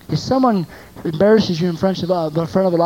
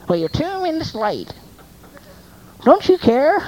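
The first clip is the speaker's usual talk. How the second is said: high-pitched nagging reproach; mock parental scolding; 8 semitones higher than usual. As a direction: scold a latecomer, pitch climbing at the end. Acts out scolding late daughter.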